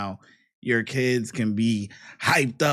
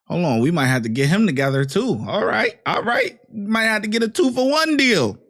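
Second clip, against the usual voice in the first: louder, amused excitement; excited joking; 7 semitones higher, 8 dB louder than usual. Real, not acted. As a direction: crack the joke with raised voice.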